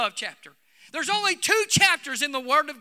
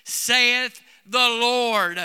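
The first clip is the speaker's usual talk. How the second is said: slow, weighty scripture reading; drawn-out solemn quotation of the Lord; solemn scripture quote; much slower than usual. Acted. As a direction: intone the verse slowly like holy writ. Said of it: "The word of the Lord says".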